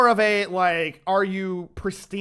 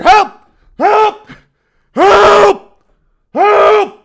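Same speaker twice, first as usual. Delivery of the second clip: high, loud yelling help; mock cries for help; 14 semitones higher, 16 dB louder, much slower than usual. Streamer hamming up a panic over his blood pressure, loud cries. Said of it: "I gotta chill out".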